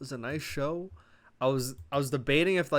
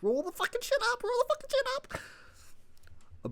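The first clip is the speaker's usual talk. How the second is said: much higher, slowing squeaked order; mock high frantic cue; 4 dB quieter than usual. Vlogger imitating the cameraman, high and slowing. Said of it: "see the cameraman going".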